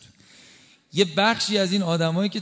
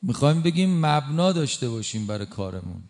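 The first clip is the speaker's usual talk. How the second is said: ordinary delivery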